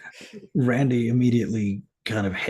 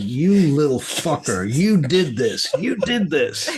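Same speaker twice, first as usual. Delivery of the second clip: raised, louder, indignant finger-pointing voice; acted accusation; 5 semitones higher, 4 dB louder, slower than usual. Randy blames Jackie for the branch, accusing. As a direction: build from annoyed to high-pitched outrage.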